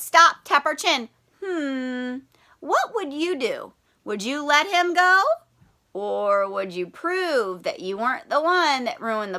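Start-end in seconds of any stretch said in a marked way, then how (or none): none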